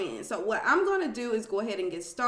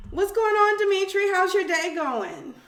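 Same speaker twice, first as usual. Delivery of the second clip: high, loud customer service greeting; chirpy call-center hello; work voice; 10 semitones higher, 6 dB louder than usual. Real, not acted. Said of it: "I don't know why I'm talking like that".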